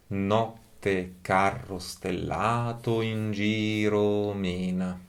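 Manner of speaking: "Notte carro stellato in giro mena" read slowly, each stressed syllable marked; metered recitation. Shown as a demonstration. Teacher reciting the sonnet line to show its accents, slow and measured. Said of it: "So now with, uh, the words".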